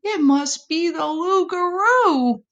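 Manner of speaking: high frightened possum; scared. A narrator's reading. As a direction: squeak it out in fear. Possum terrified.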